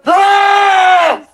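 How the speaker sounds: very loud high scream. Acted. A loud startled scream. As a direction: scream in fright.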